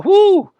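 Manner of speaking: loud high whoo, blowing off steam; loud, high exasperated whoo; heated whoop. Real, not acted. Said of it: "Boy, I am heated right now".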